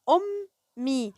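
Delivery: "أمي" slowly, the م held and sounded twice; teacher stressing the shadda in "أمي"; doubled consonant. Shown as a demonstration. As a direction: split "أمي" between its two m sounds. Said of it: "أنا نطقت هنا الميم مرتين".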